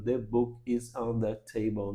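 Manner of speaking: slow, word-by-word 'The book is on the table'; robotic textbook English. Shown as a demonstration. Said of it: "não fala que nem um robozinho". ('The book is on the table' robotizado, palavra por palavra)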